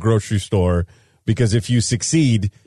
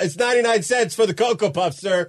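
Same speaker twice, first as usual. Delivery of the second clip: higher, a lightly voiced complaining shopper; mock fussy shopper; 6 semitones higher than usual. Retelling Ray Romano's bit, lightly voicing a fussy shopper. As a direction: play the customer scolding the cashier. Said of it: "Ray Romano said it best".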